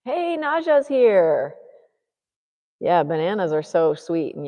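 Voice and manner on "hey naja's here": high, slow sing-song hello; high drawn-out greeting; greeting Naja. Real, not acted. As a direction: coo a delighted hello up high.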